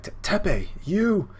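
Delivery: stammered, high mock cry of disbelief; stammer, then exclaim high and loud; hammed-up startled recognition; acted shock. Acting out character shocked at arrival.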